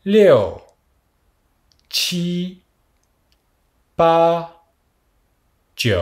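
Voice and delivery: "Léo", "Chi", "Pa", "Jiu" as separate, toned Mandarin syllables; model for repetition. Shown as a demonstration. Teacher counting for students to repeat after, careful model pronunciation of "Léo. Chi. Pa. Jiu.". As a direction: say "Chi" and "Pa" high and held, with pauses after each. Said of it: "répétez après moi".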